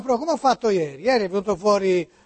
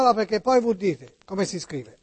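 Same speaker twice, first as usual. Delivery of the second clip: ordinary delivery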